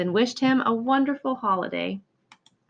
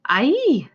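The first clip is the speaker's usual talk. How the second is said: startled cry; 6 semitones higher, 6 dB louder than usual. Acted. Hamster brushes Edgar's face.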